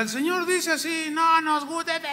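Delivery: high mocking voice of the people. People mocking Jeremiah in a high voice. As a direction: sneer back at the prophet, pitched high. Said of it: "Y iba y les decía".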